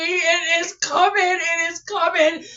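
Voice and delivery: ordinary talk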